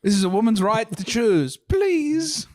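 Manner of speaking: high mock-indignant baby squeak; sassy baby voice. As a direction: huff it like an offended little baby. Joining the sassy baby bit.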